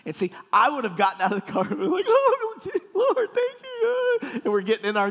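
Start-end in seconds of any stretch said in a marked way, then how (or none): 2.1-4.4 very high, drawn-out squeal of gratitude; mock overjoyed thanks. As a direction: cry out thanks, hammed up. Acts overjoyed at receiving new car.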